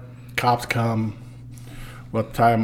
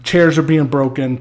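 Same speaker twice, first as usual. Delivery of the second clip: usual speaking voice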